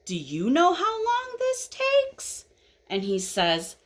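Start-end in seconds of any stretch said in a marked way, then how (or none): 0.1-2.5 high, slow wheedling question; impatient caterpillar. Acted. Caterpillar asks how long changing takes, impatient. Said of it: "And so now he says".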